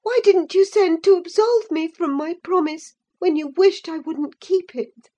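high, loud impatient questions; indignant demand. A narrator's reading. Catherine demanding an explanation from Linton. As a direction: press him hard for the truth.

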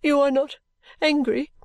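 high-pitched, tearful question; acted weeping daughter, halting and high; sobbing. A narrator's reading. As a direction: ask anxiously through sobs. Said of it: "sobbed poor Caddy at last".

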